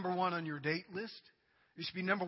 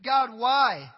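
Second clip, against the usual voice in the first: pleading why; 4 semitones higher, 7 dB louder than usual. Acted. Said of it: "He says, "You ask, 'Why".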